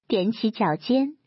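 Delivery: ordinary delivery, nothing marked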